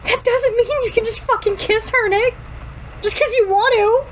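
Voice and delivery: high-pitched angry retort; acted indignation. A narrator's reading. Girl rebuking Nick over the kiss, indignant. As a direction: tell them off, pitched well up.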